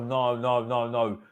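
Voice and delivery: ordinary talk, nothing marked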